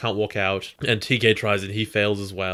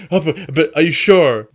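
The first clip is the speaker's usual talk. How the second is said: hammed-up pleading; 6 semitones higher, 8 dB louder than usual. Joe runs back pleading.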